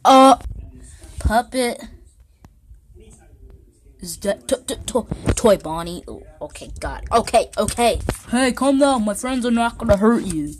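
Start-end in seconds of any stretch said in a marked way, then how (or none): 0.0-1.8 loud high startled cry; startled yelp. Real, not acted. Jump scare by puppet. 7.1-10.5 urgent loud reassuring. Acted. Calming a frightened character.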